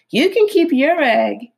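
high, breezy fox voice; sly fox voice. Acted. Fox dismissing the egg.

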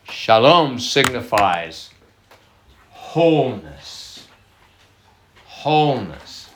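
drawn out, weighty pauses; slow emphatic teaching. Shown as a demonstration. Pastor slowly stressing wholeness to listeners. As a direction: lean on each word slowly.

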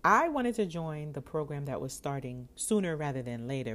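normal talking voice

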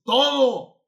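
sudden high shout; a preacher's triumphant shout. Real, not acted. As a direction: burst out with the answer.

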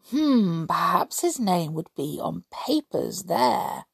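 ordinary delivery, nothing marked

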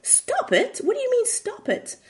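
high-pitched, incredulous echo; hammed-up indignant questioning; offended. A narrator's reading. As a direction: squeal it back in affronted disbelief. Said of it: "of course she's offended".

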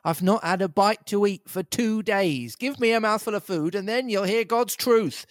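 high, wheedling whine; beggar's whine. Acted. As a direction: plead for food like a tramp. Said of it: "in a real beggar's whine".

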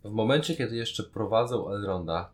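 ordinary delivery

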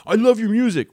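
loud, high-pitched mock fan gush; gushing fanboy. Imagined fan fawning over a famous musician, gushing mock fanboy. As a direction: blurt it out like an overexcited fan. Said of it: "that's what fanboyism is".

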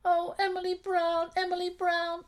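high tearful teddy cry; weeping teddy bear. Acted. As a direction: wail her name in teddy tears. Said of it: "wept the tearful teddy bear".

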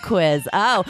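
ordinary talk

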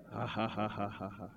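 low, soft deliberate ha-ha laugh; put-on laugh in even, separate beats; laugh of faith. Shown as a demonstration. Preacher showing how to laugh at Satan, deliberate faith laugh. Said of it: "Laugh in faith".

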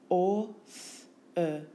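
Orth-er as separate phonemes or, th, er; sound by sound. Shown as a demonstration. Teacher showing phonemes, Orth-er sound by sound.

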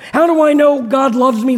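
normal talking voice

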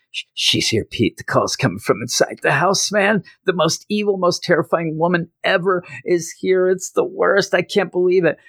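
stammering start climbing to high-pitched panic; mock terrified. [name] warning Pete about the woman inside, hammed-up fear. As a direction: play scared out of your wits, pitch shooting up. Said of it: "He is so scared".

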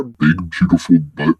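deep, punched-out words for comic relish; mock deep voice. A narrator's reading. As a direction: drop suddenly low and loud. Mock relish while narrating.

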